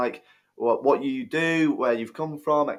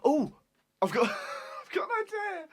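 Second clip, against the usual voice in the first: excited. Real, not acted. Sudden idea during blindfold quiz.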